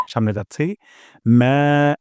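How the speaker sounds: ordinary talk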